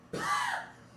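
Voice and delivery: voiceless rasped bird gasps; dying eagle. Acted. Streamer showing off a dying eagle, voiceless gasps. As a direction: do a dying eagle in breathy bursts. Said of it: "I can do the dying eagle".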